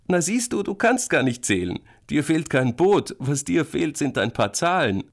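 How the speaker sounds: high, mocking sing-out; amused teasing. A narrator's reading. Big sister mocks brother's wrong counting, teasing. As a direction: tease a little brother, pitched high. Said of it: "Jetzt muss Penny lachen".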